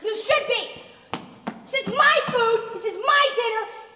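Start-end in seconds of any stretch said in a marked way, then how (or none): 1.7-3.8 worked-up, indignant tirade; raised, exasperated acted outburst; angry rant. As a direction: tell off the nag who is ruining dinner. Angry rant at nagging friend.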